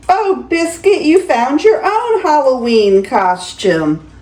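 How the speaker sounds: lively, sing-song picture-book reading; grandma reading a bedtime story; reading to children. Acted. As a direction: read it to a small grandchild with delight. Grandma reads picture book aloud.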